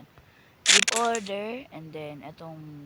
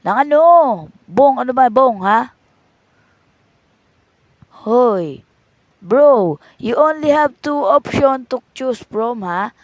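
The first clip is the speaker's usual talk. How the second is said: exasperated shouting; 3 semitones higher, 11 dB louder, slower than usual. Acted. Vendor losing patience with customer.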